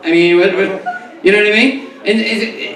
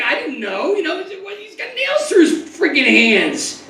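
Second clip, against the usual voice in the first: usual speaking voice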